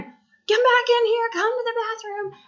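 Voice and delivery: retold high-pitched holler. Stuck mom calling son back to the bathroom, high holler. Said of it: "So I'm hollering".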